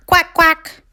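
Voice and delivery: high, short quacks; calling ducks. Acted. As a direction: call out like huddled ducks. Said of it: "they called".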